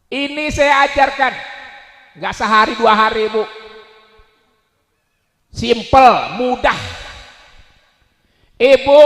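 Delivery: loud, high rally-style sermon; impassioned preacher's shout; full-force preaching. Real, not acted. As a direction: hammer each phrase out to the crowd.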